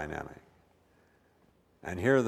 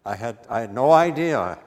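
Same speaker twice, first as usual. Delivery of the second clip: "I had no idea" pushed out louder; louder outcry; 8 dB louder than usual. Retold or imitated. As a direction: Simon realizing it, a burst of alarm. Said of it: "Oh, Peter".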